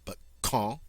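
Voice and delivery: quand with a hard K and a nasal ah, no O at the end; correcting to the French quand. Shown as a demonstration. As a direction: say quand as kahn, not quando. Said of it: "notice not quando, but quand".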